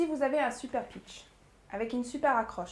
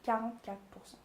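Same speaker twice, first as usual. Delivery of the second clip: "Quarante-quatre pour cent" softer, evenly separated; repeated for emphasis; 6 dB quieter than usual. Shown as a demonstration. Coach repeating the key figure, "Quarante-quatre pour cent" softly deliberate. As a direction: let "Quarante-quatre pour cent" land softly after a long pause. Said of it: "je vais répéter trois fois".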